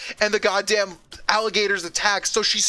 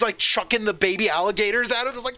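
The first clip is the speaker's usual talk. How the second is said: ordinary talk, nothing marked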